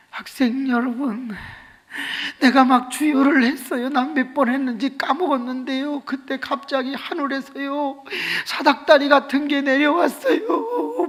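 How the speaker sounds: imitated testifying girl. Girl telling students of heaven's ladder, high mock-tearful delivery. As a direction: impersonate a girl giving a wailing testimony. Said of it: "얘가 하는 말이".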